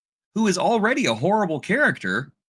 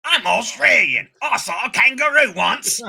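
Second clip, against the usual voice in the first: hammed-up, higher Australian caricature; bad Australian accent; 4 semitones higher, 4 dB louder than usual. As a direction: do a broad mock-Australian voice. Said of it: "they made Wolverine Australian again".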